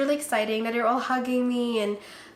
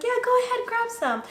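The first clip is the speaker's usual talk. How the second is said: high, coaxing push; eager urging; 13 semitones higher than usual. Retold or imitated. Urging coworkers to open the donuts, high and eager. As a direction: nudge them toward the box, high. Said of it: "I'm like".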